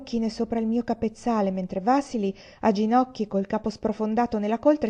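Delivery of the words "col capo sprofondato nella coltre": plain reading voice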